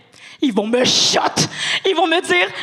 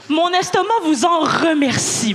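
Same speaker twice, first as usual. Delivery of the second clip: ordinary talk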